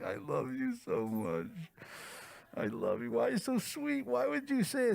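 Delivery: high-pitched, hammed-up tearful gushing; mock sobbing. Girlfriend sobbing over his wish about her kids, mock sobbing. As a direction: imitate someone sobbing with love, voice shooting way up. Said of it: "She started sobbing".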